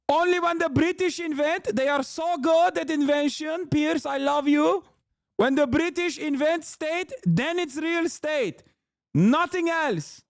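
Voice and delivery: high-pitched, hammed-up Arabic-accented impression; mock Arabic accent. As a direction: parody the guest in a thick Arabic accent. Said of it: "He just sounds like that".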